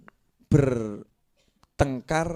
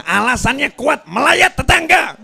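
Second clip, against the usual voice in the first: higher and louder, mocking the excuse; excited climax; 12 semitones higher, 9 dB louder than usual. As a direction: build to a loud, high punch. Preacher's rising mocking climax. (nada tinggi dan keras penuh semangat)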